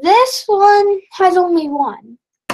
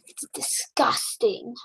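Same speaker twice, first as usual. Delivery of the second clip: normal talking voice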